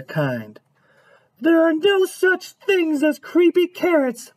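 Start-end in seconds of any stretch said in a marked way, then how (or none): 1.4-4.3 high-pitched mom voice; gently voiced mother dismissing a child's fear; dismissive mom. A narrator's reading. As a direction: speak up high as a doubting mom. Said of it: "Mom said, shaking her head".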